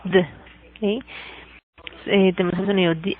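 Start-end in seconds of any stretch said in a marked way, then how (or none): 0.1-0.2 "the" as a bare voiced d; "the" reduced to a lone d sound; isolated D sound. Shown as a demonstration. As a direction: say "the" as just the consonant d. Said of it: "el sonido de la D".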